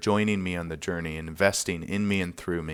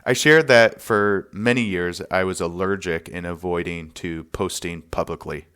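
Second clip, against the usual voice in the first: ordinary delivery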